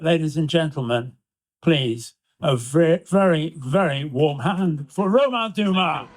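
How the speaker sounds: rousing event host send-off; stage announcer. Acted. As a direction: introduce the guest to a live crowd. Presenter calls applause for guest.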